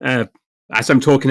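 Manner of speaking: normal talking voice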